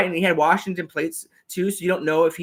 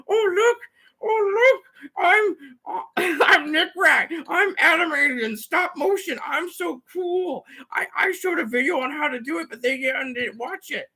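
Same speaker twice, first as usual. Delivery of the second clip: much higher, slow, smug mocking voice; mocking Nick Rack; 12 semitones higher, slower than usual. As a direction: parody a show-off bragging about his animation. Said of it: "he probably would just have animated stuff on the bottom going".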